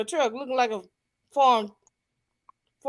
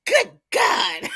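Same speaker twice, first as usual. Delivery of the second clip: startled exclaim; 10 semitones higher than usual. Retold or imitated. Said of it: "They're like".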